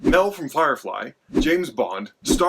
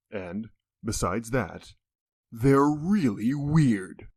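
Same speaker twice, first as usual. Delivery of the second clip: slow deadpan; 2 semitones lower, much slower than usual. Acted. Disclaimer punchline, drawn out.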